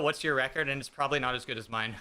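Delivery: normal talking voice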